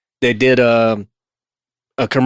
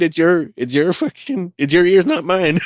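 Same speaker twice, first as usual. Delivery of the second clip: usual speaking voice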